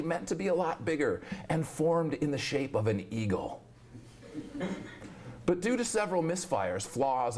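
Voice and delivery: animated mock-lecture recital with swooping pitch; performed poem reading. Poet reads new science poem to audience, animated mock lecture. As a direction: recite it like a wry popular science talk.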